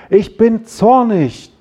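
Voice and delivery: slow, high, emphatic declaration of anger; demonstrated anger. Shown as a demonstration. Preacher showing how to voice feelings, emphatically angry. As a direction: stretch each word out in mock wrath. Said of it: "Du kannst auch deine Gefühle ausdrücken".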